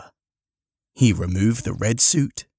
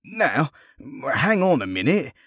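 higher-pitched, taken-aback objection; protesting; 6 semitones higher than usual. Acted. Harry to the woman hustling him inside, protesting. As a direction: object to being rushed along. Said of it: "protested Harry".

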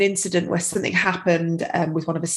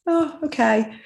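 imitated calmed child; 7 semitones higher than usual. Toddler stops crying after mum's tapping, lightly imitated calm acceptance. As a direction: lightly mimic a child suddenly settling. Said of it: "she just kind of stopped".

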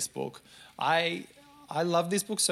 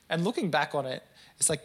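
ordinary delivery

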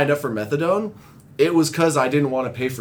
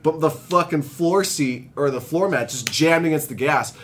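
ordinary delivery

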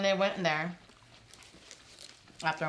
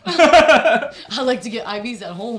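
laughing; 3 semitones higher, 15 dB louder, slower than usual. Real, not acted. Joking about IVs, laughing.

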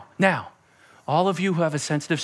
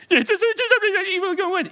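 whiny believer; 13 semitones higher than usual. Pretend. Dialogue of believer with Jesus.

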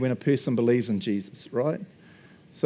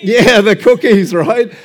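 laughing; 7 semitones higher, 11 dB louder, slower than usual. Real, not acted. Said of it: "laughing reply".

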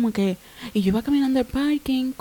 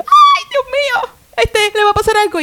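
high, loud hammed-up wail; retold panic; 13 semitones higher, 5 dB louder than usual. Overanxious mother to her husband about the daughter, mock panicked cry. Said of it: "yo me vuelvo loca".

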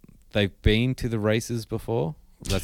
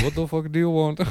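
grumpy driver; 7 semitones higher than usual. Pretend. Voicing grumpy tram driver.

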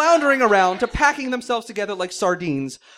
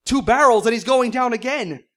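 shout; 4 semitones higher, 7 dB louder than usual. Acted. Brody shouting about the shark.

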